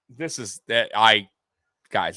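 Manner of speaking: normal talking voice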